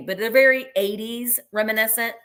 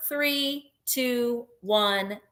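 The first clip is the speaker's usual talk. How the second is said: normal talking voice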